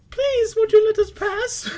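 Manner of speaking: high falsetto pleading woman, slow. Acted. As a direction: beg to pass in a squeaky high woman's voice. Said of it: "forgive my acting".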